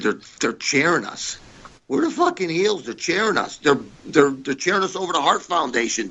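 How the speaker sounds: telling his partner the crowd is cheering them, rising amazed excitement; retold excited disbelief. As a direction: pitch jumps way up, incredulous and thrilled.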